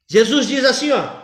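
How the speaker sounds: normal talking voice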